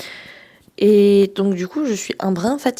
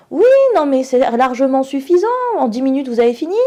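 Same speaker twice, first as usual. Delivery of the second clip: high, loud, mocking teacher voice; high, loud smug-teacher impression, retold in anger; 5 semitones higher, 5 dB louder than usual. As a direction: parrot the smug professor with contempt. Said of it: "Et il nous sort quoi?".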